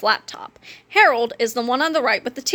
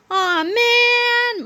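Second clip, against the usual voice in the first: put-on high, whiny childish groan; 11 semitones higher than usual. A narrator's reading. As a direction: drag it out like disappointed kids.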